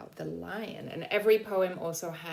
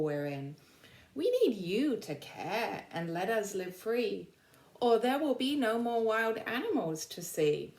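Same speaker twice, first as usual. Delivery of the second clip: bright, higher read-aloud voice for the class; reading poem to children; 5 semitones higher than usual. Acted. Teacher reading an animal poem to the class, bright and lilting. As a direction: read it like a storybook rhyme to kids.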